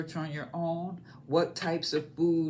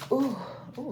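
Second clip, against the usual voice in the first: hiccuping through the words; hiccups; 8 semitones higher than usual. Real, not acted. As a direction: caught by a hiccup mid-word. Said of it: "I got the hiccups".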